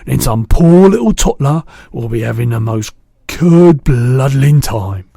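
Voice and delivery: loud firm outcry; determined cry. A narrator's reading. BFG promising Sophie, loud determined cry. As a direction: vow it loudly.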